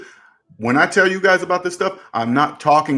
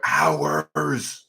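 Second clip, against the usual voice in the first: "hours" stretched out very long; drawn-out stress; 5 dB quieter than usual. Real, not acted. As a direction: drag "hours" out as if it never ends. Dwells on the long wait.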